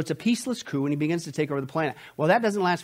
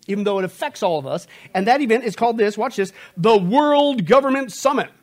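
high, loud, full-force pulpit warning; fiery preaching; 6 semitones higher, 6 dB louder than usual. Acted. Preacher warning the congregation about the summit, high and loud. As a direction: preach it hard, rising and pressing.

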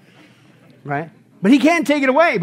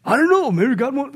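imitating doubtful people; 5 semitones higher than usual. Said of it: "It's like people say".

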